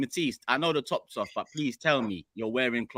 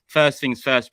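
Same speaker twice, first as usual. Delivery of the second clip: louder announcer call; presenter opener; 3 semitones higher, 7 dB louder, slower than usual. Acted. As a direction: open the show with punch. Presenter opens the show.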